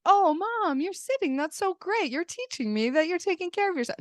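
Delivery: mock-sweet, high sing-song child voice; gushing kid. Imagined kids praising Mom for resting, mock-gushing. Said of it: "the kids are not gonna be like".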